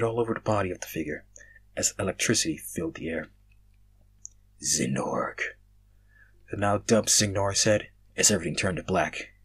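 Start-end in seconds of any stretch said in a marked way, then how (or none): 4.6-5.4 very low, hushed name; deep name reveal. Acted. The dark figure reveals its name, low and ominous.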